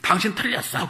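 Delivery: a board member cuts down another in a meeting, sharply and loud; blunt accusation. Retold or imitated. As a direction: snap it at the meeting. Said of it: "회의를 하잖아요".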